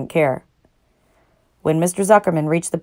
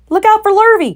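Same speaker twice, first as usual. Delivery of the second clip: very high loud warning call; 7 dB louder than usual. A narrator's reading. Cows calling a warning to Wilbur. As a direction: warn him across the yard. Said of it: "called the cows".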